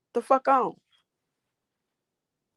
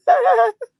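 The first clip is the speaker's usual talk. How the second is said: high, loud burst of laughter; loud high giggling fit; real laugh; 8 dB louder than usual. Real, not acted. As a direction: burst out in a high-pitched cackle. Laughs at a chat comment.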